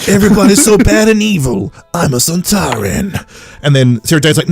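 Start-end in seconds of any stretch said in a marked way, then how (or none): 0.1-3.2 lightly voiced alien villain, starting high; Sontaran. Alien villain introducing himself, lightly hammed-up high boast. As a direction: boast with mock villainy, slowing at the end. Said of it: "And the Sontaran's like".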